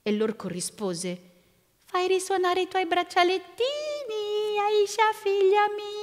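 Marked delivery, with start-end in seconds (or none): 1.8-5.9 very high, held singsong; ogre posing as grandfather. A narrator's reading. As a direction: chant it high and false, stretching the endings. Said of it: "quella non era la voce del suo nonno".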